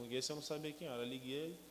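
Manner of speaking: ordinary talk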